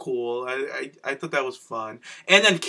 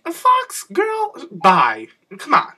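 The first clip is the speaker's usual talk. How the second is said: very high, exasperated falsetto; exaggerated high-pitched incredulity; mock disbelief; 8 semitones higher, 5 dB louder, slower than usual. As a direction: squeak it out, dismissing her worry. Acts out dismissing Fox's worry.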